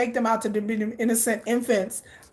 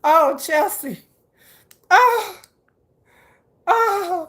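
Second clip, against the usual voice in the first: high, playful wailing; mock hurt; 7 semitones higher, 4 dB louder, much slower than usual. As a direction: whine in pretend anguish, then insist. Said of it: "You just hurt me".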